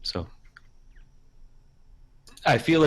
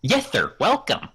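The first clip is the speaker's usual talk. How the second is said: high, slightly louder put-on politeness; interviewer guy; 8 semitones higher, 5 dB louder, slower than usual. Guy welcomes Tolkien to the interview, acted polite voice. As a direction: greet the old author deferentially. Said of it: "I'll be the guy".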